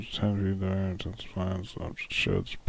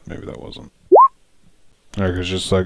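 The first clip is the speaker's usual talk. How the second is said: ordinary delivery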